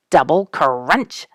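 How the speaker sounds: slow imitated crunch; drawn-out crunch. A narrator's reading. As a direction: stretch the word out like chewing. Porcupines chewing, repeated.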